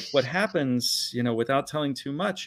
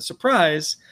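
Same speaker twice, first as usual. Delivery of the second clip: louder, higher retold "surprise"; mock surprise shout; 5 semitones higher, 6 dB louder than usual. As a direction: imitate someone springing a surprise, louder. Voicing boyfriend's surprise party greeting.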